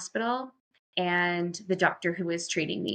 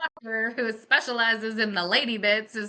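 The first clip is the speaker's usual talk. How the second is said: sarcastic jab; 3 semitones higher, 5 dB louder than usual. Retold or imitated. Mocking the doctor, raised.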